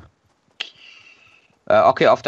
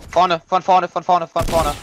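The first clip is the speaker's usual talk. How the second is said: urgent warning shout; 4 semitones higher, 4 dB louder, slower than usual. Real, not acted. Warning of enemy ahead.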